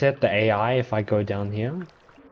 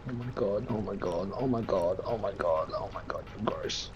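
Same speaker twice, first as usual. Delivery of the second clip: disgusted mutter; 5 semitones lower, 10 dB quieter, faster than usual. Real, not acted. Repeats under breath.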